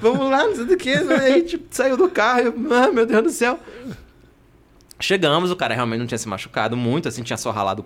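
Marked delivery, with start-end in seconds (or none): 0.0-0.9 high-pitched, flustered urging; re-enacted panic. Driver going to the fallen cyclist, re-enacted panic. 2.7-3.6 high, dismayed cry; re-enacted panic. Driver getting out to see the cyclist, acted panic.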